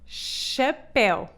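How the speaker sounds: chapéu slowly, ch as sh, open é; slow model word. Shown as a demonstration. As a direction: draw out chapéu. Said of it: "Esta palavra diz-se chapéu".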